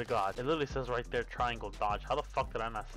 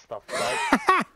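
high, loud outraged squawk; shocked yelp; 9 dB louder than usual. Real, not acted. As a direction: yelp in disbelief. A mob takes his bed.

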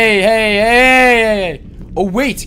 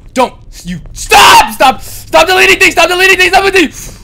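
angry yelling; 7 semitones higher, 8 dB louder than usual. Real, not acted. Yelling at game monster.

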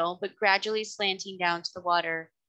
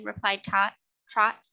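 ordinary delivery

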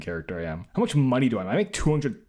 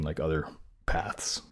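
a soft whispered aside; 6 semitones lower, 5 dB quieter, slower than usual. Real, not acted. Telling viewers the quiz lacks paths, whispered. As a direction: breathe it out as a little secret.

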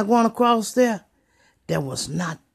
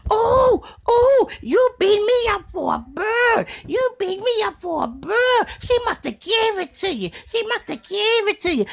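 much higher, crying wail; mock wailing girlfriend; 14 semitones higher, 4 dB louder than usual. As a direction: wail it like she's hurt and sobbing. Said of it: "she's holler".

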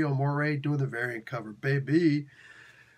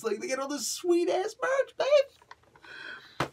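squeaky high-pitched gushing; high silly voice; much slower than usual. Pretend. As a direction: gush way up high. High put-on voice.

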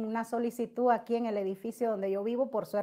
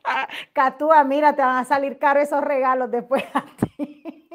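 laughing tease; 3 semitones higher, 10 dB louder than usual. Real, not acted. Teasing viewer about gifts.

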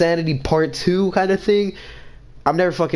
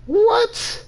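high, startled yelp of disbelief; jolted, can't believe the screen; shocked what. Real, not acted. Sudden shock in game.

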